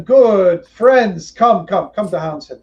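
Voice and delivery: a big man welcoming newcomers; booming welcome. Acted. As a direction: call them in like a big host. Mayor welcomes refugees loudly.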